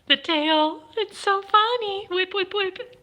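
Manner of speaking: high, giggly gushing; delighted high coo. Real, not acted. Owner gushing at her cat's whipping tail, high. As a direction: squeal over the tail.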